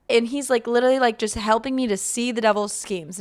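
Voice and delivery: ordinary delivery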